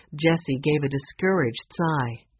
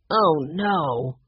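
ordinary delivery

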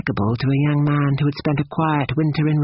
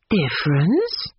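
slow, questioning cry with raised pitch; suddenly alert; 4 semitones higher, slower than usual. A narrator's reading. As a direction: catch the word and query it, drawn out. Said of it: "cried Missus Honeychurch, suddenly alert".

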